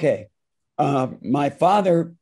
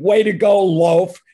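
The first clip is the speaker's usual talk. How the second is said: loud jeering shout from the crowd, higher and louder; heckler's yell; 4 semitones higher, 5 dB louder than usual. Retold or imitated. Heckler in the crowd mocks the singer with a loud shout. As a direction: yell it across the concourse like a rowdy fan. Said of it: "some guy screamed".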